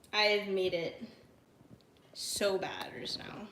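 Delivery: slow, very soft whisper; dismayed whisper. Real, not acted. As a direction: whisper it slowly to yourself. Dejected muttering over the mishap.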